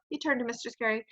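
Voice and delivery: ordinary delivery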